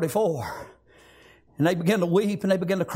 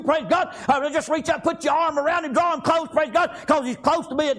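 raised, urgent exhortation; fervent preaching; 7 semitones higher than usual. Acted. Pastor urging the congregation to embrace God, fervent. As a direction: press the pews to reach out. Urges the church to draw close.

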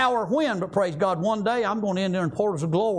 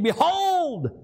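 high, slow, drawn-out proclamation; solemn divine pronouncement, stretched out; great voice from heaven; 5 semitones higher, slower than usual. Acted. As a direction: give God's voice booming weight, very slowly. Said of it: "a great voice out of heaven saying".

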